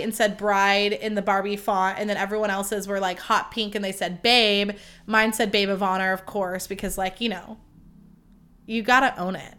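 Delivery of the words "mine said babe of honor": usual speaking voice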